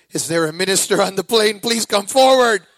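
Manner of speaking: urgent pilot call. Retold or imitated. Pilot calling for a minister.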